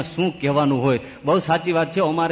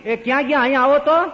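shout; 12 semitones higher, 5 dB louder, faster than usual. Acted. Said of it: "એ બૂમ પાડી".

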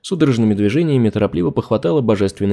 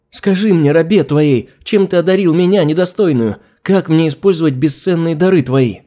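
fervent pleading; 5 semitones higher, 3 dB louder than usual. Acted. Servant begging her master.

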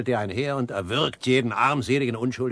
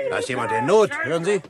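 alarmed call; 6 semitones higher, 5 dB louder than usual. Acted. Hears a fight outside.

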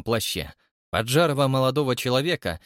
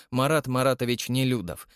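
usual speaking voice

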